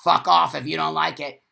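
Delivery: loud defiant shout; loud, confrontational snarl of defiance; aggressive attitude. Pretend. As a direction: bash in yelling at them. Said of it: "come in with an attitude like that".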